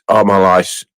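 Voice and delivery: ordinary delivery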